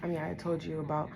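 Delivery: quiet, holding back tears; emotional, subdued. Real, not acted. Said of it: "I'm getting emotional again".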